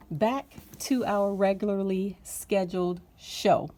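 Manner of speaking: ordinary talk, nothing marked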